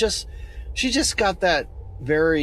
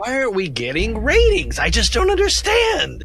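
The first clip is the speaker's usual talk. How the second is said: high mock wail about ratings; mock despair; 9 semitones higher than usual. Host whining to listeners about ratings, high mock wail. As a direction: whine it up high like a wounded host.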